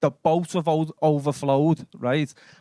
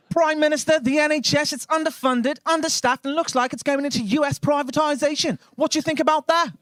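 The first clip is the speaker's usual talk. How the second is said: press reporter; 9 semitones higher than usual. Acted. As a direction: fire it at the PM like a hungry reporter. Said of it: "The national press".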